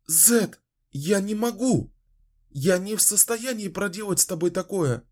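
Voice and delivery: high, horrified pleading; horrified refusal. Acted. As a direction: recoil in horror as you plead. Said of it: "в ужасе отпрянула". (в ужасе)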